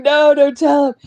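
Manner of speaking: imitated whiny plea; big-baby pleading. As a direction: beg like a babyish sick person. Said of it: "being a big fucking baby".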